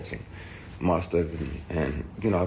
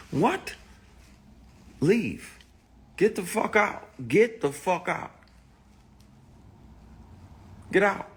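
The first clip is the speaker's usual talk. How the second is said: furious shouting; 13 semitones higher than usual. Retold or imitated. Telling the groper to get out, furious high yelling. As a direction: throw him out at the top of your voice. Confronting the man who groped him.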